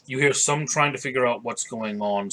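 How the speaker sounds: ordinary talk, nothing marked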